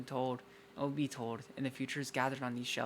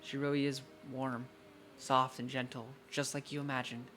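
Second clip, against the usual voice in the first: ordinary talk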